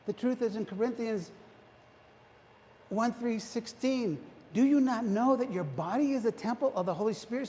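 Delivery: high, loud preaching at full force. Acted. Preacher rousing the congregation, high and loud. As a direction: drive it home to the congregation.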